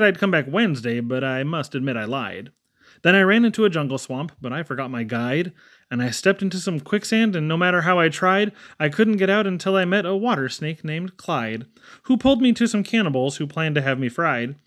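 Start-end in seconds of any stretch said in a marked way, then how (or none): none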